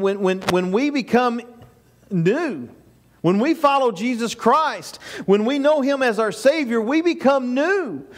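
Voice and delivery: ordinary talk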